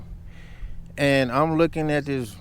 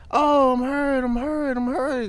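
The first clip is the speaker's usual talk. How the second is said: mock screaming woman; 14 semitones higher, slower than usual. Imitating the other driver's fake pain cries, high mock wail. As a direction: ham up a woman faking injury. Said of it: "she starts screaming and yelling".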